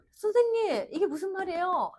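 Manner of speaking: high-pitched student calling out to the teacher; confused student. Acted. Student asks the teacher what this means, confused and high-pitched. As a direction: pipe up like a puzzled pupil.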